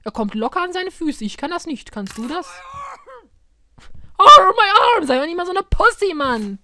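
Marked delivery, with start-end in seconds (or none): 4.2-5.1 loud cry of pain; pained yell. Real, not acted. Yelps at game injury.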